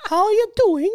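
very high, badly faked British accent; bad English accent. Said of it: "not a very good English accent".

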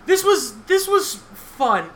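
drawn-out sarcastic fun. Real, not acted. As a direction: say it like it was anything but fun. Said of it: "the sarcasm in my voice".

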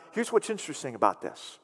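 normal talking voice

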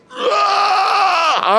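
hammed-up furious shout with no words; injured biker raging on the road, mock yell; mock rage yell. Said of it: "He is raging".

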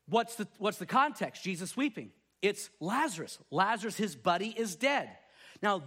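ordinary delivery

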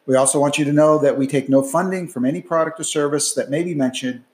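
loud, steady disclaimer reading; fast legal disclaimer. Acted. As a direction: rattle off the legal fine print. Legal disclaimer read after outro.

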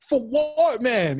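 high-pitched, incredulous retold question; baffled retort. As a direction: act out being totally baffled by the thanks. Retelling his baffled reply to stranger.

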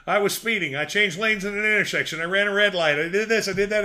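high, loud rapid babbling; frantic confession. Retold or imitated. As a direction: blurt every sin at once. Jim Carrey confessing to cop.

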